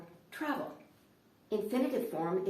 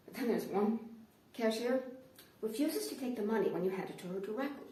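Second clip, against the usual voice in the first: ordinary talk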